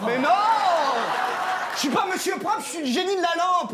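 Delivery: indignant. Acted. Indignant correction.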